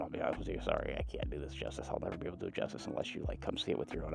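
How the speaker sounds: hushed, breathless whisper. Real, not acted. Rider awed by the view, whispering to viewers. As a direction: drop to a whisper in awe.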